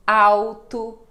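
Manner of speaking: alto said loud as aw-tu, L like U; L as U sound. Shown as a demonstration. Teacher demonstrating to students, alto loud with L as U. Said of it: "quando ela está no meio de palavra".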